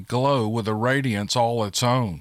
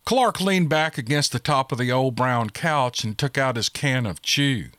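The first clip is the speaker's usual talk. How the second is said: plain reading voice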